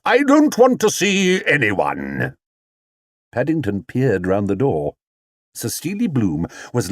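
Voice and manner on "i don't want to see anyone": high, slow theatrical refusal; sulky star dismissing all; grand actor. Acted. Actor continues booming.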